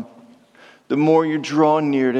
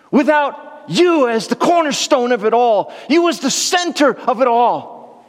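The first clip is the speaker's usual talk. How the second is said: fervent preaching; 8 semitones higher than usual. Acted. Preaching David's words fervently.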